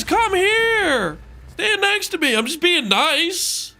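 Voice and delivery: put-on squeaky girly game-character voice; high-pitched Zana voice. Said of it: "She says".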